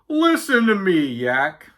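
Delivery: high, loud, plaintive moan; moaning Walrus. A narrator's reading. Walrus begging Yak to listen, moaning. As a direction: moan it like a put-upon walrus. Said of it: "he moaned".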